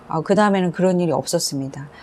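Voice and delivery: ordinary talk, nothing marked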